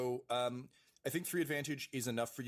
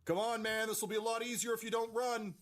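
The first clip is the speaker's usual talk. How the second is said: high-pitched mock yell; strained, high battlefield call; acted yell; 14 semitones higher, 3 dB louder than usual. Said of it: "Dyrath just yell".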